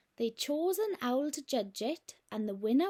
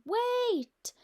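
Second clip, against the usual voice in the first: startled knight; 6 semitones higher, 4 dB louder than usual. Acted. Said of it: "who told the dragon".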